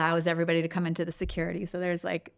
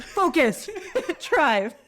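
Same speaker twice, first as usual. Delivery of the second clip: laughing; 10 semitones higher, 4 dB louder, much slower than usual. Retold or imitated. Urging driver, laughing.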